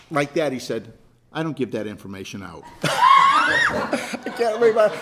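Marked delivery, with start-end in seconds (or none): 1.3-2.6 curt flat clerk. Retold or imitated. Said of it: "Voice never changed". 2.7-4.9 high, loud laughter breaking through the words; laughing. Real, not acted.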